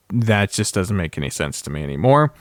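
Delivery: normal talking voice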